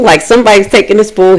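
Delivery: ordinary delivery, nothing marked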